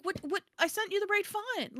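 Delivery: high-pitched retold disbelief; flabbergasted. Author retelling her protest to the cover designer, flabbergasted. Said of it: "I was just flabbergasted".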